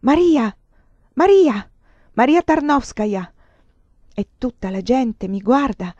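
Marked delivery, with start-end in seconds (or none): 0.0-3.3 high, loud cries of the name; the cry echoing in the street; repeated shout. Acted. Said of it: "tutti sembrano ascoltare quel grido".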